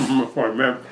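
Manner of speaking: talking through a mouthful; words round a bite of food; mouth full. Real, not acted. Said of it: "don't talk with your mouth full".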